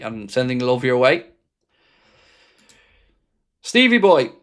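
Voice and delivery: ordinary delivery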